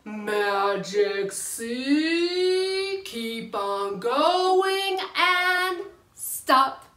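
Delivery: drawn-out, slow instructive chant; slow demo. Shown as a demonstration. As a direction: stretch each phrase out slowly. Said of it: "Here we go".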